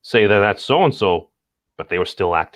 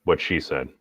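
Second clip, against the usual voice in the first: normal talking voice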